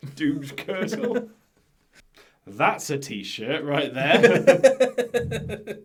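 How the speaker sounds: laughing through the words; laughing in disbelief at the name. Real, not acted.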